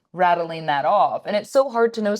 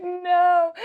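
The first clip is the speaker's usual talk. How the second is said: high, startled no through a laugh; shocked no; 13 semitones higher than usual. Retold or imitated. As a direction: squeak out a stunned no. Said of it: "I was like".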